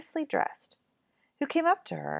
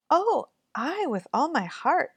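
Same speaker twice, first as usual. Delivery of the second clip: put-on sweet girl's reply; 8 semitones higher, slower than usual. Kind girl answers the old woman.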